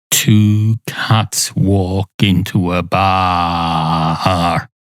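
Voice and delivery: very slow, drawn-out telling; joke-opener drawl. Acted. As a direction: stretch every word to set up the joke. Opens a told joke.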